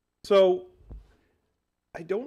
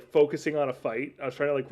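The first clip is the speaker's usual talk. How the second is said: ordinary delivery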